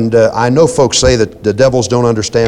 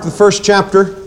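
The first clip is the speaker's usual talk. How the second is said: ordinary talk, nothing marked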